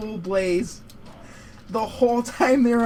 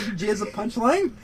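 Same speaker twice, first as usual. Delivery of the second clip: ordinary talk, nothing marked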